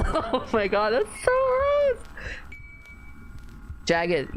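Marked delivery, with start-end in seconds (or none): 0.1-2.0 high, slow squeal of disgust. Real, not acted. Streamer reacting to teeth in the sink, disgusted squeal. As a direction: squeal like you can't stand it. Reacting to gross story scene.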